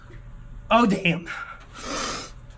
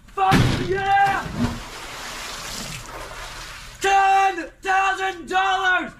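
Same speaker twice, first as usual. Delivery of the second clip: real, high-pitched screaming at a huge win; yelling in real disbelief at winning; ecstatic shouting; 10 semitones higher, slower than usual. Real, not acted. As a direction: scream with joy, voice cracking high. Huge lottery ticket win.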